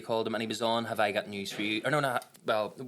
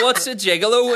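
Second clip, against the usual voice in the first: laughing retelling; 12 semitones higher, 13 dB louder than usual. Retold or imitated. Child asking mum, laughing retelling.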